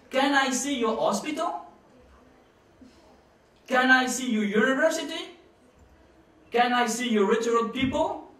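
loud rhetorical challenge. Real, not acted. Speaking to pro-Putin listeners, loud challenging questions.

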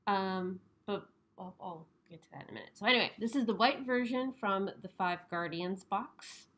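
muffled by mask; speaking behind covering; through a face mask. Real, not acted. Seen 3: Disposable mask covers her mouth and nose throughout; removed after 11.1.

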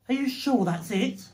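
ordinary delivery

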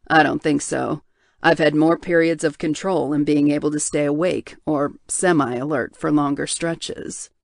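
low, heavy voice of a guilt-ridden man; tortured bear shifter. Acted. Hale, drugged, answers.

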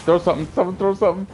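high, fast frantic call; urgent plea. Real, not acted. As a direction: rush the words out frantically. Urgent plea during fight.

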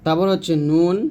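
usual speaking voice

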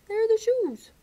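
high, loud and eager: there are the shoes; excited pointing. Real, not acted. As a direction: show the picture with big excitement. Reader points at picture excitedly.

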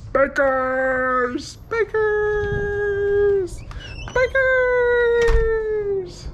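high, drawn-out falsetto cries; mock fanfare. Vlogger announces dinner at Baker's, hammed-up high cries.

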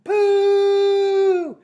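very high, loud disgusted cries; shouted. A narrator's reading. Witches crying out at the smell, shouted. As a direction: build to a long high howl of "pooh". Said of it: "they shouted".

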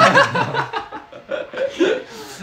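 shrieking laughter; loud high laugh. Real, not acted. Cohost shrieks with laughter at the pee joke.

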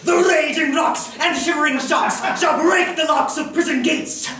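ranting tyrant, high and loud; booming tyrant. Acted. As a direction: bellow it like a stage tyrant. Bottom bellows a tyrant's lines.

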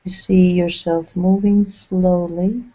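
unhurried guide voice with long pauses between phrases; measured, spacious phrases, leaving silence to settle; guided meditation. Acted. Channeling a meditation for listeners relaxing along a path, guided meditation.

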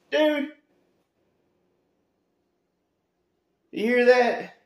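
high, loud mocking disbelief; incredulous outburst. Real, not acted. Mocking a contractor's bragging, high and loud. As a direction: scoff loudly at the boast.

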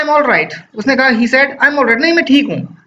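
a small boy pretending to be fine; child's voice. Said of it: "तो वो बोलता है". (बुखार में बच्चा ज़िद करता है कि वह ठीक है, ऊँची बच्चे की आवाज़)

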